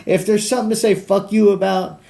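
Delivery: high, loud, carried on a laugh; laughing exclamation. Real, not acted. Comedian cursing the Jazz Drive, high laughing delivery. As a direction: push it out while cracking up. Laughing after his own punchline.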